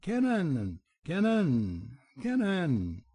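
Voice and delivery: high, piercing bird call; a bird's shrill warning call, repeated; harsh pheasant cry. A narrator's reading.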